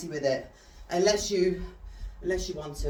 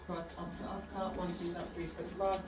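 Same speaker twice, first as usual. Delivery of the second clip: boxing coach calling combos; 2 semitones lower, 9 dB quieter than usual. Acted. Calls combos while demonstrating.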